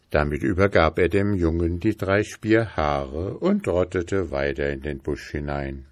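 ordinary delivery

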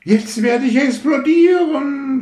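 sehr hoch und laut verzückt geschrien; Schrei. Acted. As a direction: schrei es voller Wonne heraus. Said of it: "schrie sie". (Rakete schreit entzückt im Flug)

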